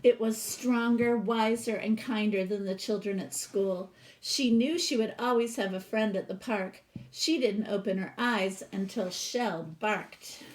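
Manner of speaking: lively read-aloud storytelling voice; storybook reading. Acted. Teacher reading a picture book to her class, expressive read-aloud.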